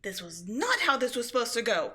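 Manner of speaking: loud, bitter rage; furious that the plan failed; angry rant. Acted. Villain raging at failed plan.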